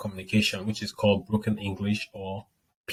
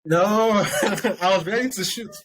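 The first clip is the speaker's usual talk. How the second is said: laughing; 10 semitones higher, 4 dB louder than usual. Real, not acted. Laughing about saying swears.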